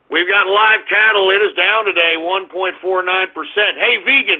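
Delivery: normal talking voice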